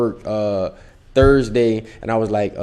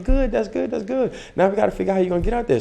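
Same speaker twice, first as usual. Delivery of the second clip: mom voice; 11 semitones higher, faster than usual. Retold or imitated. Mom replying to the news.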